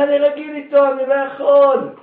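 hammed-up weeping rabbi who cannot part with his jug; crying out. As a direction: plead through mock tears. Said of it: "רק אל תבכה, רק אל תצעק".

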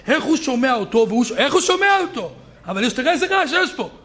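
high, acted incredulity; baffled visitor. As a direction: puzzle aloud over the din. Baffled visitor acted amid study-hall noise. (תמיהה נרגשת בקול גבוה)

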